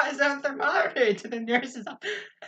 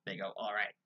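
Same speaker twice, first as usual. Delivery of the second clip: much lower, softer pompous voice; hushed, lowered mock-grand patient; 16 dB quieter than usual. Pompous kid voice mocked, very low.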